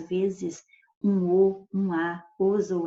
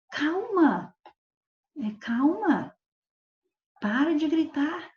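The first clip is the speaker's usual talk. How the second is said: higher, pleading calma to stop the yelling; urgent calming; 7 semitones higher, slower than usual. Shown as a demonstration. Teacher acts out calming someone who is yelling. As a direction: hush someone who is shouting. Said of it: "se eu falar assim".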